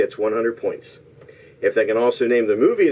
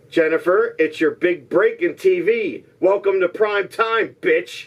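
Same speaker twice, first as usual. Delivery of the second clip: higher-pitched theatrical delivery; hammed-up movie quote; 6 semitones higher than usual. Host reveals the movie line, hammed-up delivery. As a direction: play the villain's punchline big.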